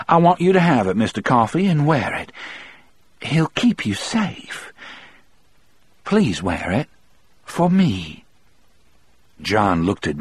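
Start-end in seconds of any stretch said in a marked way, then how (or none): 0.1-8.2 high woman's voice, gently insistent; earnest grateful woman. A narrator's reading. Melinda gives John her medallion to wear, earnestly pleading. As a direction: press the gift on him, voice lifted high.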